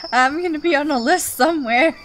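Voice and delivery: high loud cackling laugh round a fast line; real laughter. Real, not acted. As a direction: laugh helplessly while confessing. Guilty laughter after game violence.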